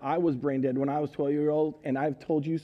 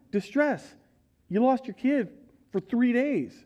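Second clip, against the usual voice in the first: ordinary talk, nothing marked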